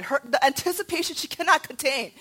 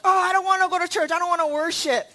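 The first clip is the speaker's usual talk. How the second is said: mock child's whine, higher and quick; whining child; 4 semitones higher, 4 dB louder, faster than usual. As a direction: complain like a reluctant kid, imitating. Parent imitates reluctant children.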